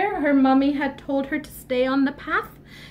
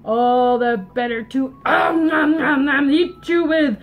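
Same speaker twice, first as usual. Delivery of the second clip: loud wolf building to gobbling; booming, greedy storybook wolf; hungry big bad wolf; 5 dB louder than usual. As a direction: chomp through the words loudly. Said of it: "the Grandma and the wolf said".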